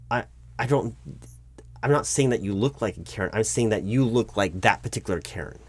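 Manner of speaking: ordinary delivery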